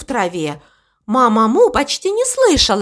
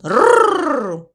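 higher rolled-r growl; tiger growl; 7 semitones higher than usual. Acted. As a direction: trill a pretend tiger growl.

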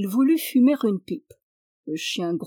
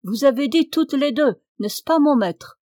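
louder, higher call from a window; 5 semitones higher, 6 dB louder than usual. A narrator's reading. Servant at the window asks master below, calling out. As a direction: shout the question down to someone below. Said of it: "s'adressant au seigneur qui l'attend en bas".

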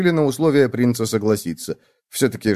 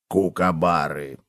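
low, slow, soft prompt; supply the word quietly, low and drawn out; low plodding cockroach voice; 6 semitones lower, 4 dB quieter, slower than usual. A narrator's reading. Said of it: "подсказал таракан".

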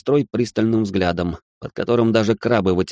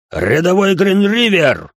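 loud, high, slow bark; drill sergeant; 10 semitones higher, 5 dB louder, slower than usual. A narrator's reading. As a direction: call the private to attention. Ray barks at private.

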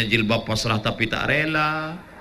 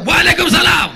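higher, louder, firm phone answer; 14 semitones higher, 8 dB louder than usual. Shown as a demonstration. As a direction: answer the phone firmly and loud. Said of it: "kau harus tegas". (jawaban telepon yang tegas dan lantang)

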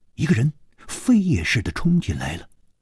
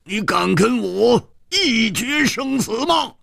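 high angry shout; furious roar; 10 semitones higher, slower than usual. Acted. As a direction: bellow the challenge in rage. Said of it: "怒喝道".

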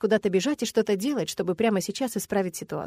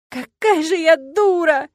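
loud, high drawn-out wail; 8 semitones higher, 7 dB louder, slower than usual. A narrator's reading. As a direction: bawl it out in self-reproach. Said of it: "ревела я в подушку".

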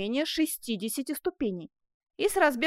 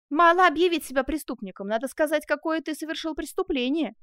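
raised, higher impatient voice; exasperated insistence; 5 semitones higher, 5 dB louder than usual. Acted. Jailer lectures a man begging for arrest, exasperated. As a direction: lay down the rule, exasperated.

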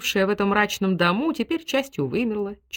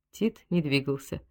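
ordinary delivery, nothing marked

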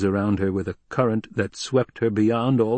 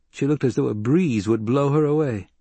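ordinary delivery, nothing marked

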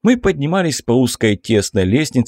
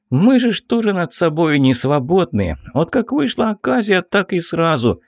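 pleading excuse; 7 semitones higher than usual. Acted. Metelka excusing himself.

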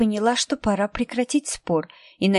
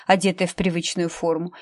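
plain reading voice

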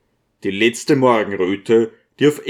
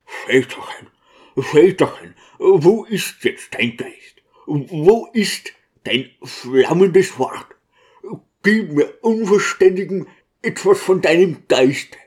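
beseech in a raised pitch, drawing out the words; grieving plea; 7 semitones higher than usual. A narrator's reading. Deacon leaning over the dead provost, pleading for his spirit.

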